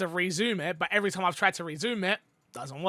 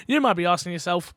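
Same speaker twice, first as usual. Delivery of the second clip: ordinary talk, nothing marked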